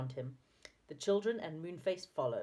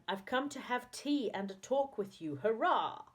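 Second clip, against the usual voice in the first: higher, cheering delight; joyful; 6 semitones higher than usual. A narrator's reading. Saucepan Man arriving to have tea, joyful. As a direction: announce it happily, ending on a cheer.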